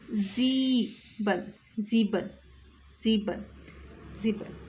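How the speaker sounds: ज़ीबन with z and long ee; German sieben. Shown as a demonstration. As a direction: say ज़ीबन slowly, z and ee. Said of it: "ये वाला ई है". (धीरे से ज़ीबन, लंबी "ई" के साथ)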